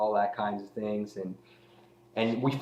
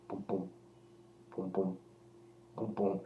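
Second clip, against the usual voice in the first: imitated heartbeat; 2 semitones lower, 13 dB quieter than usual. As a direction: mimic a hushed heartbeat with pauses between beats. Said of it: "maybe you get this heartbeat".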